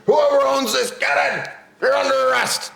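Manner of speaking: high, hollered police order; mad cop. Retold or imitated. Cop arresting the four-wheeler's owner, acted angry holler. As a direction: holler it as an enraged officer, acted. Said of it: "this cop was mad".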